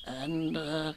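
soft, halting little voice, rising; husky. A narrator's reading. Piglet dreading what follows, huskily. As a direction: stammer it out faintly and anxiously. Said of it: "managed to say very huskily".

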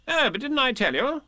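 high, airy reply; breezy animal character voice; Rabbit. A narrator's reading. Rabbit replies lightly.

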